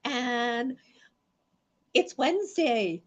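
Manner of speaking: slow, high, loud announcing; excited announcement. Real, not acted. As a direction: draw it out like a show opener. Show opener announcement.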